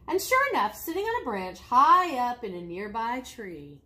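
plain reading voice

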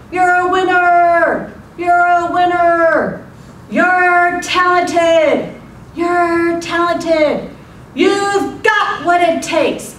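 slow, high, drawn-out loud call; hollering out. Acted. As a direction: holler praise across a valley, stretching each word. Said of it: "the father hollered out".

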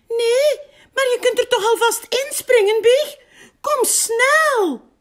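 very high, loud, hurried little voice; squeaky mouse voice. A narrator's reading. Muis urges Big to jump in, squeaky and loud. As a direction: squeal it impatiently like a tiny mouse.

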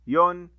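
يون said as a loud "un" ending; tanween sound. Shown as a demonstration. Teacher reviewing damma tanween, loud and short. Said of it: "تنوين بالضم".

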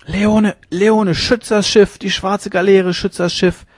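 high, strained cry of a delirious man; feverish shriek. A narrator's reading. Dying captain calling on Leone to save the ship, shrieking. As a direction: shriek an urgent order from a fever dream. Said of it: "kreischte der Sterbende im Fiebertraum".

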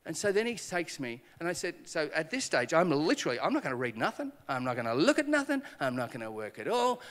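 normal talking voice

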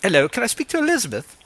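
imitating an editor's fake British accent; mock British accent. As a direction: put on a fake posh British accent to prank-call. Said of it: "I do a great British accent".

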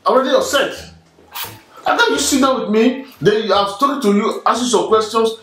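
high, heated, upset complaint. Real, not acted. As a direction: argue back, voice climbing. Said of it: "Here is your voice".

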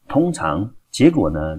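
normal talking voice

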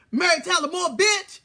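high, loud yell imitating Keisha; mock yell. Host imitates the stage manager yelling in his face, loud and high. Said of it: "jumped in my face and said".

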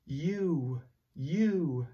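normal talking voice

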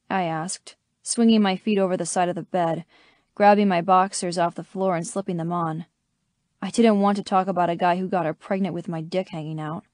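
usual speaking voice

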